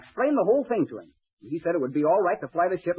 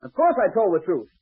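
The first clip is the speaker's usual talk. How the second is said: higher, louder cocky comeback; indignant retort; 6 semitones higher, 4 dB louder than usual. Acted. Young pilot answering the official who doubted his word, indignant. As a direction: throw it back at him, affronted.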